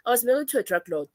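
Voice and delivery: normal talking voice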